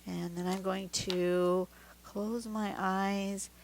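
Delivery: slow, hushed card pick; slow and soft. Real, not acted. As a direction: draw it out as if eyes closed. Slow card pick with eyes closed.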